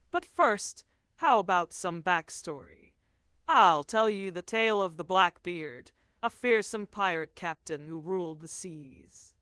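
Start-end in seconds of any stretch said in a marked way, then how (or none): none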